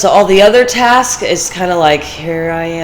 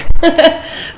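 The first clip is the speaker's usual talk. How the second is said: short bright laugh, breathy and high; quick amused laugh; 10 semitones higher than usual. Real, not acted. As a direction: laugh at your own little joke. Laughing at own joke.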